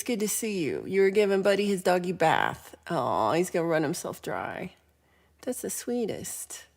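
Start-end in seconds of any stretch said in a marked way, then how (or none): none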